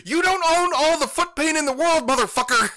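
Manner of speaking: high, loud exasperated yell at a friend; furious shout. Retold or imitated. Friend finally snaps at amputee, shouting high and loud. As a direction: bellow it, finger pointed, fed up. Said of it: "slams his hands down and points at me and goes".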